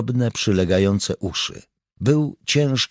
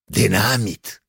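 a touch of pride; understated proud reply; 3 semitones higher, slower than usual. A narrator's reading. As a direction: announce it proudly. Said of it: "odparł z dumą stary".

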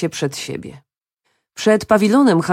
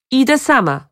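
higher-pitched called-back reply; shouting back; 8 semitones higher, 4 dB louder than usual. A narrator's reading. Woman refusing to wait for the card player, shouting back. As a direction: call it back over a distance. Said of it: "odkrzyknęła".

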